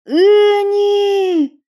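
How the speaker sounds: high desperate scream of a name; anguished shout. Acted. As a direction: cry out in horror. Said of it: "крикнул он".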